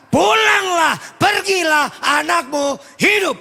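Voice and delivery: high preaching building to a loud shout; impassioned preaching. Real, not acted. Preacher retells Jesus healing official's son, rising to shouts. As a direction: drive the sermon up to a shouted climax.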